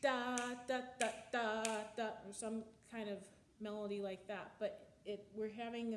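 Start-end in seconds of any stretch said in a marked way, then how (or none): 0.0-2.0 each da punched louder between the beats; syncopated da. Shown as a demonstration. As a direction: sing the da sounds syncopated, off the beat. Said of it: "with syncopated rhythms".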